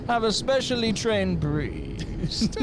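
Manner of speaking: high, slow, theatrical villain voice; mock-menacing, drawn-out proclamation; Bane. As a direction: stretch the words like a grandiose villain. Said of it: "Has Bane got a job at the Vatican?".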